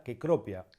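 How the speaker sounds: "Cecropia" said as ke-KRO-pia; Latin hard C. Shown as a demonstration. Teacher giving a plant example, hard k opening "Cecropia". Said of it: "La letra C en latín".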